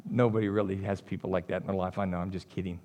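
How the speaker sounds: soft, low joking aside; a hushed wink to the congregation; quiet teasing aside. Real, not acted. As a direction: tease the crowd under your breath.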